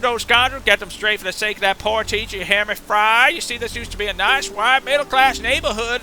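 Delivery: put-on high, ranting southern-accented fish; fish character voice. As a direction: go off on a hammed-up fish tirade. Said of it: "do the voice of the fish there".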